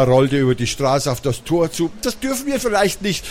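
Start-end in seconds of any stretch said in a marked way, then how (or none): 2.0-3.3 put-on high, softish worried boy's voice; hesitant little brother. A narrator's reading. Simon objects to his sister entering the factory, hesitant. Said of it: "rief Simon seiner Schwester zu".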